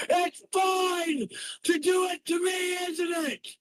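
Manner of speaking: high screamed accusation; furious shout. Real, not acted. Streamer screaming at his chat, furious shout. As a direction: hurl it at them at the top of the voice.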